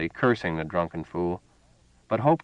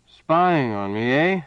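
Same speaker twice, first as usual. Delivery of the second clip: put-on drunk suspicious officer; 6 semitones higher, 4 dB louder, slower than usual. A narrator's reading.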